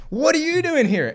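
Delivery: high, loud startled demand; startled shout. Acted. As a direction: bark the question at the intruder. Kid confronts the burglar.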